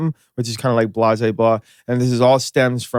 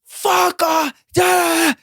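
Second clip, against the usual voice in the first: high mock yell of an angry mother; mom screaming back. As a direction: fire it back like a mom losing it. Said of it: "screaming at each other".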